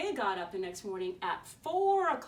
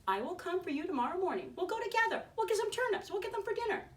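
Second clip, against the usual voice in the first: much higher, eager wolf voice; sly wolf; 9 semitones higher, faster than usual. Acted. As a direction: sweet-talk the pig. Wolf tempting the pig.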